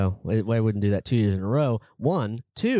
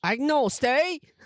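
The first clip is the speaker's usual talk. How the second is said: high, slow put-on British accent; drawled British agnostic; much slower than usual. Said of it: "More British".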